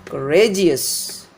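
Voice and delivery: courageous as cuh-RAY-jus, slow; weak cou, long RA in courageous; stress on middle syllable. Shown as a demonstration. Teacher contrasting with courage, courageous stressed on RA. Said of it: "syllable that is before this suffix should be stressed".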